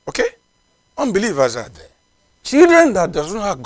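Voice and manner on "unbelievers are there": ordinary talk, nothing marked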